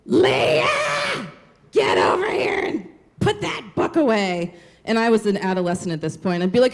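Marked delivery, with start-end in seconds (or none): 0.1-4.5 grandma yelling. Retold or imitated. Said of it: "communicated was through screaming".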